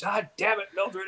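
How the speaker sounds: mock exasperated outburst, pitched high. Husband blames Mildred for leaving game early, exasperated.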